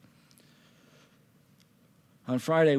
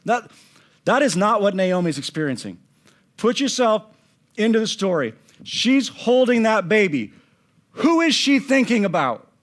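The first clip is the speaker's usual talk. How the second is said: raised, insistent preacher's voice; emphatic preaching; 7 semitones higher, 5 dB louder than usual. Acted. Preacher urging listeners to put themselves in Naomi's place, insistent. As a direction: press the listeners into the story.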